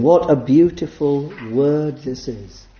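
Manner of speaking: ordinary talk, nothing marked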